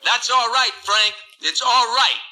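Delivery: high shouted call from the crowd; hollered. Retold or imitated. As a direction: holler it up to the stage. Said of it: "he hollered out".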